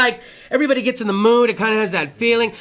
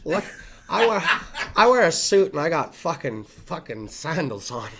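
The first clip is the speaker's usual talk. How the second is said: halting, low impression of a buddy; Schuler; 5 semitones lower, 5 dB quieter than usual. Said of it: "that's how he sounds".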